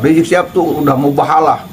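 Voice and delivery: ordinary talk, nothing marked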